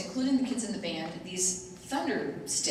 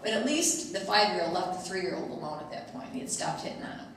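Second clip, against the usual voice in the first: ordinary talk, nothing marked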